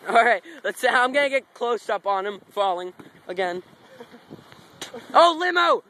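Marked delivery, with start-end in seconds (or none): 5.1-5.8 loud, high excited call. Real, not acted. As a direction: point it out thrilled. Spotting a limo, excited.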